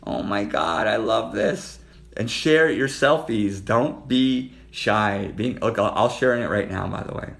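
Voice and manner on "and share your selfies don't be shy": loud, slow, drawn-out coaxing; loud drawn-out urging. Real, not acted. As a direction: stretch it out to coax them. Urging viewers to share.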